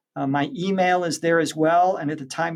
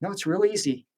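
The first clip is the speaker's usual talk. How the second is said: lightly acted brush-off of a worry, pitched up; mild, higher-voiced mock reassurance; reassuring reply; 7 semitones higher than usual. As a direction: wave away the objection, a touch higher. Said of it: "And they say".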